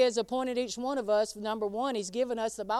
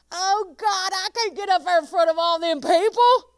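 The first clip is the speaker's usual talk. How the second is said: mock whining excuse; 8 semitones higher, 10 dB louder than usual. Mock whining believer to God.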